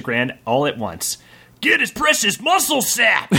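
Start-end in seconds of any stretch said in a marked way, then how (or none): 1.6-3.3 shouting. Acted. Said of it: "shouted Donarok".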